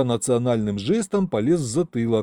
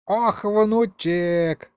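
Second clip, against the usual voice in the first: high, long drawn-out greeting; doting grandpa; 9 semitones higher, much slower than usual. Acted. Grandpa to his grandson on snapping out of it, high doting drawl. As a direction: stretch it out like a delighted old grandad.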